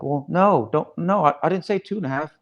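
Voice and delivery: flustered trainee. Retold or imitated. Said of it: "he's like".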